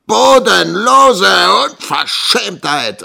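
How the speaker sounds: high, loud, drawn-out outrage; raging. A narrator's reading. Schoolmaster enraged at getting no answer, raging. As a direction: rage it slowly, stretching every word. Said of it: "raste Squeers".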